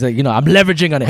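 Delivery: ordinary talk, nothing marked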